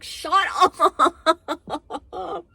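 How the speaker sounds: high, loud shout breaking into laughter; exasperated laughing yell. Real, not acted. Reviewer outraged by the Nero reference, laughing shout. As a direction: yell it in disbelief and crack up.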